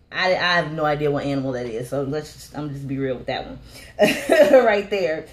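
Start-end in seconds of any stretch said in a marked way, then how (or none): 4.0-4.6 short high burst of laughter. Real, not acted. Vlogger laughing at herself to viewers, high laugh. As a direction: giggle at her own honesty. Laughs at not knowing the animal.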